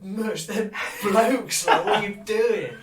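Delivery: cracking up while telling him; laughing disbelief. Retold or imitated. Telling his mate the girls were blokes, laughing.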